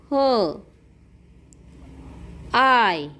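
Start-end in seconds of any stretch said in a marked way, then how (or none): 0.1-0.2 ह as an isolated "huh"; phonic H sound. Shown as a demonstration. Teacher showing the sound of H, ह as "huh". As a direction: sound out ह, not the letter name. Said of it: "एचचा उच्चार होतो".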